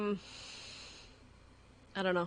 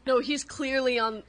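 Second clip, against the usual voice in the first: ordinary talk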